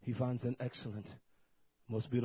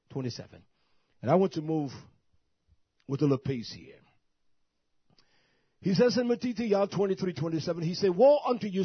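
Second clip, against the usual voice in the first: much higher and much louder, shouting hellfire; full-force preaching; 7 semitones higher, 11 dB louder than usual. Acted. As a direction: preach it with everything you've got.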